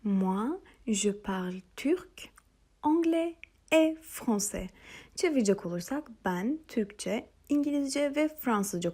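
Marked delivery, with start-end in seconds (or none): 0.0-4.7 slow, careful French answer; slow model sentence. Shown as a demonstration. Teacher to students, slow careful model answer. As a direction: spell it out slowly for learners. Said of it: "Buraya dikkat etmenizi istiyorum".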